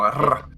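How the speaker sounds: "rr" as a rolled Scottish R; a drawn-out trilled "rr". Shown as a demonstration. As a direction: trill "rr" on the tongue. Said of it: "the roll of the R".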